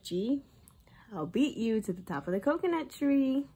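ordinary talk, nothing marked

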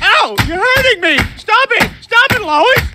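high, yelping cries of pain. Acted. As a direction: shriek as she hits you. Peter being beaten by Lois.

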